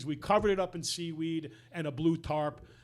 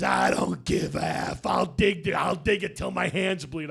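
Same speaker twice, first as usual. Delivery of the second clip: retold defiant shout; 4 dB louder than usual. Nick insists on digging.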